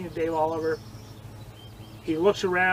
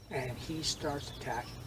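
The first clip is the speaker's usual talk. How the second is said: usual speaking voice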